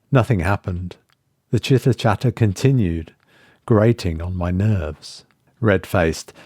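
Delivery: ordinary delivery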